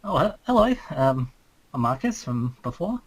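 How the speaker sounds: ordinary talk, nothing marked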